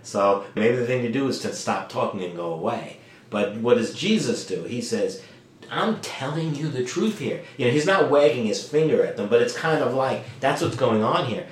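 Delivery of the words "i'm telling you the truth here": firm emphasis on TELLING and TRUTH; TRUTH leaned on, earnest and measured; emphatic. Acted. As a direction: insist on the TRUTH without scolding. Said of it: "add a little bit of emphasis".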